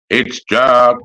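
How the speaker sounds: ordinary delivery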